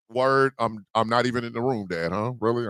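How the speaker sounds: low, soft, deadpan sulk; sulky Tiffany. Retold or imitated. Tiffany mutters to her dad, low and sulky. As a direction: mutter it like the ignored daughter. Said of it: "Poor Tiffany over here just going".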